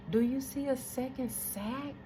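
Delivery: soft, hushed question; whispering sonographer. Retold or imitated. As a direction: murmur it like a secret between staff. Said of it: "I hear them whispering".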